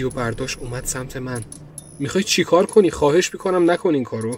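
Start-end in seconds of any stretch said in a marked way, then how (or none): 2.0-4.4 pleading. Acted. Begging with knife approaching.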